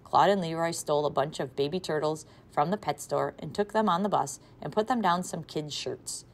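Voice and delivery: usual speaking voice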